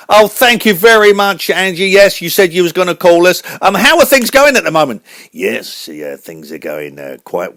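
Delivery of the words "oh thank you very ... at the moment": very high, loud cartoon host voice; radio host voice. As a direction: announce the show like a squeaky showman.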